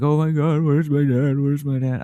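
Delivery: high, wailing panic; whiny imitation of a panicking kid; mock freaking out. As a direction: act out a kid's rising panic, pitched high. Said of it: "I'm, like, freaking out".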